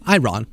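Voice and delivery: short, clearly split "Iran", like introducing oneself as Ron; succinct "I, Ron". Retold or imitated. Host mimicking how the president pronounces it, succinct "Iran" as "I, Ron". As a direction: say "Iran" as two words, "I, Ron". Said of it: "very succinctly saying their name is Ron".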